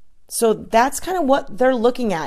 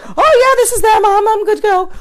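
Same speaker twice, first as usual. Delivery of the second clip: mock eager customer; 12 semitones higher, 7 dB louder than usual. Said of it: "be like".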